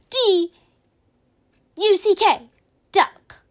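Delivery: loud, spaced-out letters; proud spelling. Retold or imitated. Pretend little brother spelling duck for the teacher, loud.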